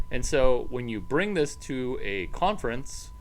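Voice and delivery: ordinary delivery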